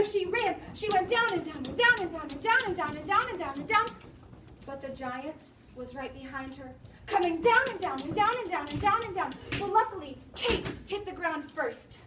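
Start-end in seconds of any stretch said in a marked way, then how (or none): none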